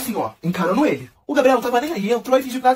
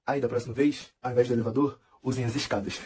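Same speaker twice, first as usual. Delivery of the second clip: low, soft, deadpan taunt; smug parting jab; 9 semitones lower, 8 dB quieter than usual. Retold or imitated. Davi leaving, taunting the group low and soft. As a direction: drop it quietly like a final insult. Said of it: "olhou pra cara de todo mundo e falou assim".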